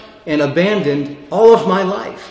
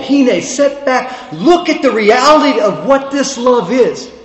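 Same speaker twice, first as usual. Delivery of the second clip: full-force preaching; 6 semitones higher, 6 dB louder than usual. Acted. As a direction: preach it to the back row.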